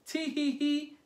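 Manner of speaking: high-pitched storybook titter; mom's mock tee-hee; acted giggle. A narrator's reading. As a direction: giggle it out in separate syllables.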